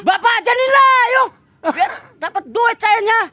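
loud cheeky comeback to the officer; mock indignant retort. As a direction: throw it back at him. Telling officer to do it himself.

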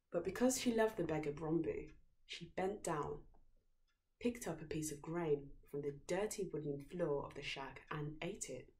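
ordinary delivery, nothing marked

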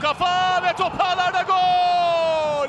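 long drawn-out goal shout; screaming football commentator. Real, not acted. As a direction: stretch the goal call out loud.

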